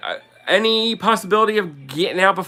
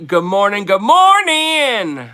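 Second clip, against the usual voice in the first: drawn-out sing-song "good morning, good morning" with the second one pitched way up and slow; Jersey good morning; 3 semitones higher, 5 dB louder, slower than usual. Shown as a demonstration. Host to viewers, reviving her sing-song morning greeting. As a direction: stretch "morning" high and long like a cheery greeting. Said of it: "I gotta see how I used to say it".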